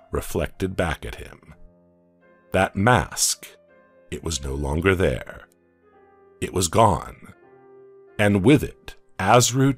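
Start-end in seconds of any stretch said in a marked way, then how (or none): none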